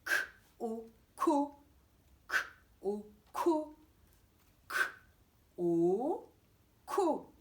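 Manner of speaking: bare K, then closed O, then joined 'ko'; sound-by-sound blending. Shown as a demonstration. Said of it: "On a juste le K et le O".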